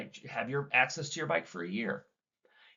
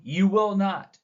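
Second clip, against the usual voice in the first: "You will not" raised higher and louder; war words stressed; 5 semitones higher, 6 dB louder, slower than usual. Shown as a demonstration. Trainer replaying the mother's war words, higher and louder. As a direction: punch "will not" as a mock threat. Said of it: "you hear the war words".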